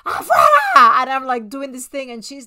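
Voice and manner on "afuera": high, loud, wailing shout; loud re-enacted cry. Re-enacting driving bad energy out the door, wailing shout. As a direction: shout it dramatically, re-enacting a tearful purge. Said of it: "I'm being really loud".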